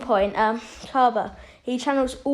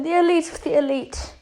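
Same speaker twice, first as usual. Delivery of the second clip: higher-pitched scornful echo; mocking Frieza; 5 semitones higher, faster than usual. Frieza laughs at Vegeta's title, higher mocking echo. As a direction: parrot the title back, pitched up. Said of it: "Frieza starts laughing".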